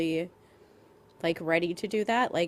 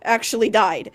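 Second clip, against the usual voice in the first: exasperated; 5 semitones higher, 9 dB louder than usual. Real, not acted. Exasperated rant about Circe.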